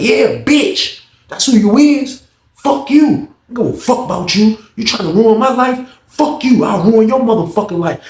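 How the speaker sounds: high, emphatic mock tirade; acted angry comeback. Inmate cursing officer over his ruined life, angry. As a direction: confirm the insult and keep attacking. Acted quarrel at full pitch.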